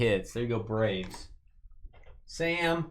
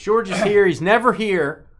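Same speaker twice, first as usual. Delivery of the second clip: mock indignation; 6 semitones higher, 7 dB louder than usual. Mock scolding about George.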